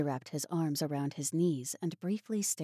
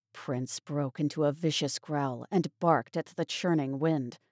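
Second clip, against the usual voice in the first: usual speaking voice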